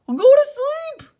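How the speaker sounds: high, loud, slow shout. A narrator's reading. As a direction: bellow it in outrage. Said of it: "shouted Toad".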